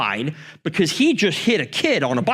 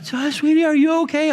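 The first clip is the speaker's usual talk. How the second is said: higher, sweet motherly questioning; mom voice; 13 semitones higher than usual. Retold or imitated. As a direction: coo like a worried mother. Mom voiced asking son.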